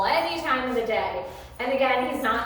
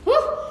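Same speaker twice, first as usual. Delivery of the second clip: loud whew; 7 dB louder than usual. Real, not acted. Exhaling to revive tired voice.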